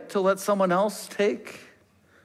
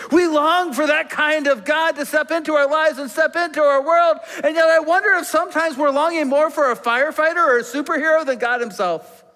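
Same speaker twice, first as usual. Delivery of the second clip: full-force preaching; 5 semitones higher than usual. Acted. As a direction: drive the longing home to the congregation.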